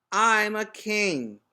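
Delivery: proud little king. A narrator's reading. Boy announcing his crown.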